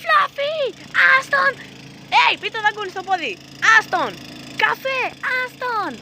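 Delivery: very high, loud shrieked commands; frantic high-pitched shouting; yelling at dogs. Real, not acted. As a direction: scream to break up a dog fight. Screaming at dogs during fight.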